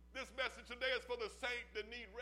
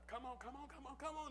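soft coaxing; 7 dB quieter, faster than usual. Acted. Preacher voices Jesus coaxing.

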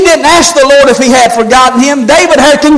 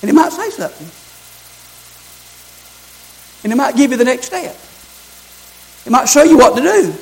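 much softer, drawn close and intimate; quiet earnest preaching; 5 dB quieter than usual. Acted. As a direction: bring them in close, softly. Softer intimate sermon stretch.

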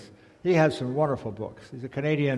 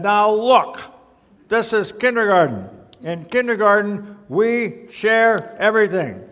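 higher voice chanting a class rule; raised-pitch schoolroom refrain; teacher and kids, instructing; 8 semitones higher, 9 dB louder, slower than usual. A narrator's reading. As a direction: recite the rule firmly, stretching the last words.

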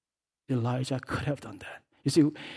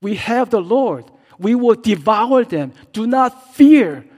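higher, louder, slow rallying cry; rally the people, fearless; bold shout; 8 semitones higher, 12 dB louder, slower than usual. Acted. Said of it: "That's what they said".

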